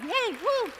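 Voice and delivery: high, bright little whoop of delight; excited cheer. Real, not acted. Florist cheering her favorite glue strips, high excited whoop. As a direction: cheer like a fan.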